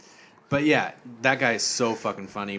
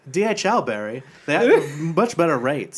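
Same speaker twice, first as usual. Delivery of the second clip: camp henchman, mostly high; lilting, mock-earnest foreign voice; Tommy Wiseau-like accent; 5 semitones higher, 6 dB louder than usual. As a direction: correct him breezily in a put-on accent. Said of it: "kinda sound like Tommy Wiseau".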